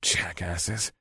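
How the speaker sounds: muttered low and soft; under my breath. A narrator's reading. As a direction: hiss it under your breath. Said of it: "I said under my breath".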